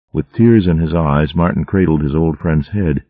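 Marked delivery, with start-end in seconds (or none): none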